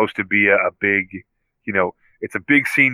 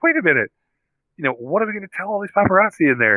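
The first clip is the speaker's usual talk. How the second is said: flustered Happy; 10 semitones higher, faster than usual. Retold or imitated. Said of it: "Happy's like".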